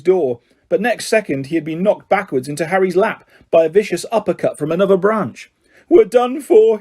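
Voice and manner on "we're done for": moaning. A narrator's reading. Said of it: "he moaned".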